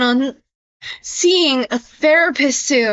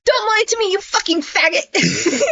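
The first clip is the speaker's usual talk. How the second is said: mock Isaac; 4 semitones higher than usual. Isaac's insult read aloud.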